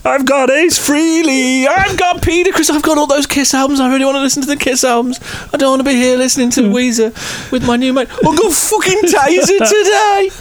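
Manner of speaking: high, slurred imitation of a drunk fan; drunken fan voice. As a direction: whine it like a drunk Midlands bloke. Voicing the drunk fan's monologue.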